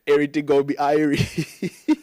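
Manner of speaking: slow, put-on Jamaican accent; hammy Brad Pitt Jamaican accent. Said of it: "Brad Pitt Jamaican accent".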